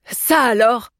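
crowing, thrilled exclamation; jubilant. A narrator's reading. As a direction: exclaim with gleeful amazement. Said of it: "jubila Humbert".

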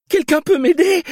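high, desperate plea; frantic high-pitched imploring; terrified pleading. Acted. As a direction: beg anyone for help in terror. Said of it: "implora-t-elle, les yeux écarquillés de terreur".

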